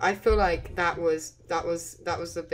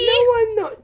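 scream into giggles; 10 dB louder than usual. Real, not acted. Denies bullying, loud shrieking protest.

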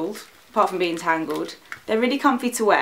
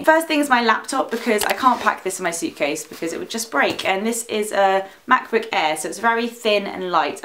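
ordinary delivery

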